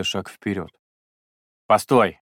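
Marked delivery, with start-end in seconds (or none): 1.7-2.2 loud cutting call; sharp stop. Acted. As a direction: cut him off loudly. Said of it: "оборвал его Жак".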